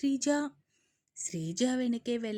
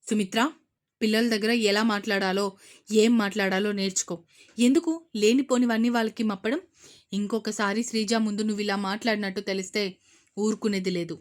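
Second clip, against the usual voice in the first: angry warning, louder; 2 semitones lower, 6 dB louder, faster than usual. Acted. Brother warning sister angrily, louder. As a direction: warn her sharply. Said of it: "కోపంగా వచ్చాయి".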